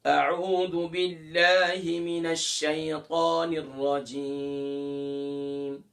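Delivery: slow, drawn-out melodic recitation; slow Quranic chanting. Shown as a demonstration. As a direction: chant it slowly with tajweed. Recites ta'awwuz in tajweed.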